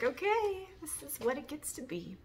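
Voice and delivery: high-pitched exclaimed acceptance; self-quote. Retold or imitated. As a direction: quote your own reaction with a leap up in pitch. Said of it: "I was just like".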